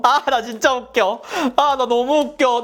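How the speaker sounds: slow, high, laughing self-mockery; amused at herself. Real, not acted. To viewers, laughing at her own antics. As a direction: crack yourself up.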